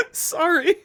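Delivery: "sorry" squeaked out through giggles; high laughing sorry; laughing apology. Real, not acted. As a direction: apologise while still cracking up. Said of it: "I'm so, so sorry".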